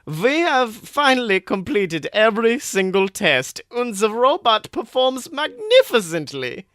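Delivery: proud scientist in a fake German accent; boasting to Kennedy in a fake German accent. A narrator's reading. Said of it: "Rugert's fake German accent".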